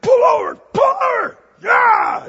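high furious shout; angry driver yelling out the window; road rage yell. Retold or imitated. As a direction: scream at him to pull over. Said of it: "he's like".

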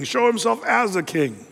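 normal talking voice

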